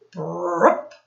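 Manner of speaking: mock croak like a pond frog; playful frog noise for the kids. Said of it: "croaked a frog".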